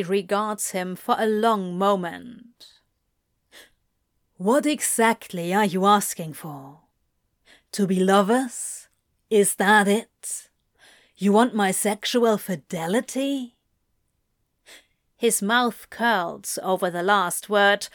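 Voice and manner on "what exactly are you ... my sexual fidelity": understated sneer building to the end. A narrator's reading. Demon questioning angel's wish for fidelity, mildly sneering. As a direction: question pointedly, a touch of sneer on the last word. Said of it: "unable to suppress a sneer".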